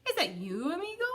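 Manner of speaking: rising hopeful high question; delighted question. Acted. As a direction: ask it full of hope. Glad reunion question.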